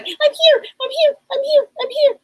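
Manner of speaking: high, insistent little call; eager imitation. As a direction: imitate an eager spirit piping up, high-pitched. Relaying daughter's eager announcement of arrival.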